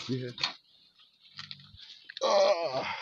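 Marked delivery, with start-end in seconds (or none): 2.2-2.9 dismayed cry. Real, not acted. Dismayed at river ahead.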